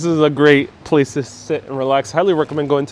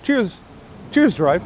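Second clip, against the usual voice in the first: higher-pitched Bristolian greeting; Bristolian accent; 5 semitones higher, slower than usual. Shown as a demonstration. Streamer showing viewers his few Bristolian words, Bristolian accent. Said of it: "say a few words in, uh, Bristolian".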